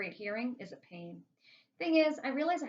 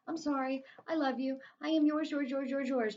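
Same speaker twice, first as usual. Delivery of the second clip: higher, pleading submissive dog; grovelling, raised-pitch plea; cowering; 5 semitones higher, 3 dB louder than usual. A narrator's reading. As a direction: beg forgiveness, repeating it devotedly. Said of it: "cowering, saying".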